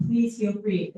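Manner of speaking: ordinary delivery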